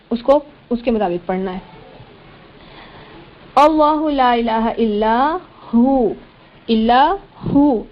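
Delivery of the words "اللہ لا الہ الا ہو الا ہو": slow, drawn-out chanted recitation; Quran recitation. Shown as a demonstration. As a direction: chant each word long and measured.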